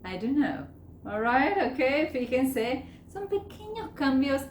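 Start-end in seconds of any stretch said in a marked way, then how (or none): 0.1-0.7 DON'T KNOW run together as DUNNO; "don't" loses its T, weak uh vowel; reduced form. Shown as a demonstration. Teacher repeating the native form, DON'T KNOW as dunno. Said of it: "ellos dicen".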